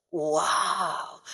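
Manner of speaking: ordinary talk, nothing marked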